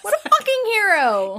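high-pitched delighted awe; fangirl gushing. Real, not acted. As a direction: swoon over the hero at the top of your range. Repeats the gushing over hero.

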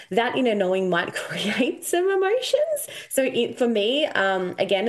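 ordinary talk

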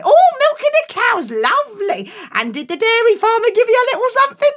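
high, loud, eager mum; delighted mum. Acted. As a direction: gush over him, quick and pleased.

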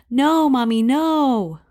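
put-on pleading little kid. Child begging mom to stop.

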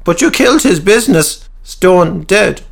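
high, sharp needling question; high voiced Cecil. A narrator's reading. Cecil needling Peter, high and sharp. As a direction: jab at him in a high voice. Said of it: "added Cecil".

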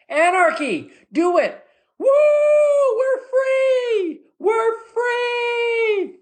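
very high-pitched celebratory whooping; mock jubilation. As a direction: cheer like a liberated rebel.

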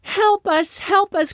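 cried for help. A narrator's reading. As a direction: beg for rescue in a panic. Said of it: "cried his grandfather and the startled woman".

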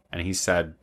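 ordinary talk, nothing marked

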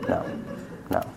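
ordinary talk, nothing marked

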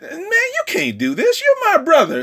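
high-pitched, lightly voiced sister protesting; a hint of a pleading young woman; Tamar pleading. Tamar refuses her brother.

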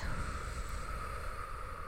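soft whispered exhale; long breathy sigh. Real, not acted. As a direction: let the breath out audibly. Breathing out during meditation.

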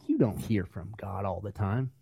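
a skeptical low sneer; scoffing Christians. Retold or imitated. Doubting Christians mocking hearing from God, scoffing. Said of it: "Christians are like".

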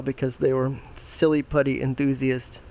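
ordinary delivery, nothing marked

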